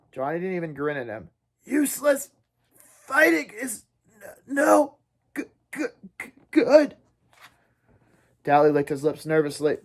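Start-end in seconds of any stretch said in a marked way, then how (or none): 1.6-7.0 weak dying struggle. Acted. Dying Johnny struggling to speak.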